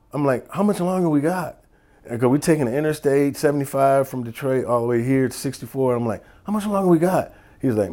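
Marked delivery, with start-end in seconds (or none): 0.5-1.6 high-pitched impatient retold question; acted-out road-trip impatience; impatient passenger. 6.4-7.3 high-pitched impatient retold question; acted-out road-trip impatience; impatient passenger.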